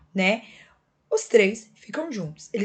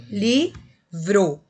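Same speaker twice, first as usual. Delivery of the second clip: livro split into li, vro, louder with a pause between; syllable by syllable; 5 dB louder than usual. Shown as a demonstration. Teacher shows consonants sharing a syllable, livro said syllable by syllable. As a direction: say livro as two separate, emphatic syllables. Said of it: "quando eu separo a sílaba".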